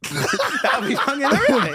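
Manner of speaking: high, loud, drawn-out laughing speech; laughing hard. Real, not acted. Podcast host cracking up at his own hypothetical reply, laughing loudly. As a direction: burst out laughing through the words.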